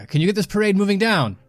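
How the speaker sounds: high, loud call across a crowd; yelling out. Acted. Hero to Kruger about the procession, yelling out. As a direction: holler it to a companion. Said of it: "Yelling out then to, to Kruger".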